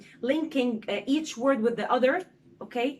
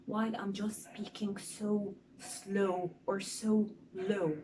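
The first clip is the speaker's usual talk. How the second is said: slow, soft, droning monotone; slow low monotone; 3 semitones lower, 6 dB quieter, slower than usual. Shown as a demonstration. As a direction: drone on slowly in one flat pitch to show the boring voice. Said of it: "with a fixed tone, like this".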